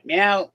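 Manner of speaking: a short playful meow that rises at the end; mock meow. Owner voicing his cat being sued, with a playful rising meow. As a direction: answer for the cat with a cute little meow.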